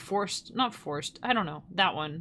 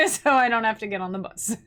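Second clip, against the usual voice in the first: laughing through the words, louder; laughing plea; 2 semitones higher, 4 dB louder than usual. Real, not acted. To a friend, asking for a lift home, laughing. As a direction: giggly ask for a ride home.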